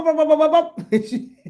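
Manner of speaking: normal talking voice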